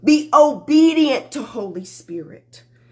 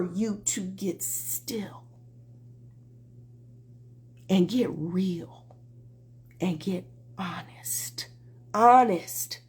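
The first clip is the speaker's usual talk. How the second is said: whispering; 5 semitones lower, 11 dB quieter, slower than usual. Real, not acted. Hushed call to honesty.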